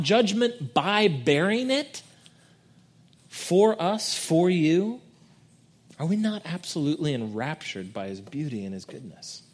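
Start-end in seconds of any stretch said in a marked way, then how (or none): none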